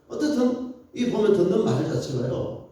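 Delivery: ordinary talk, nothing marked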